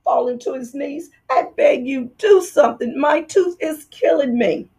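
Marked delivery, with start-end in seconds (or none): 1.3-4.7 high, pained pleading; begging in pain. Acted. Fox begging the dentist, hammed-up pain. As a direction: beg through the toothache.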